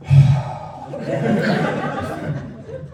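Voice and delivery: long breathy exasperated sigh; deep sigh. Retold or imitated. Goddaughter on FaceTime sighing at uncle, long exasperated sigh. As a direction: sigh like a bored seven-year-old. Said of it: "deep sigh at me".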